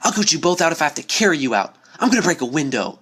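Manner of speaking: Dash fighting fear with anger; angry. A narrator's reading. Dash getting angrier.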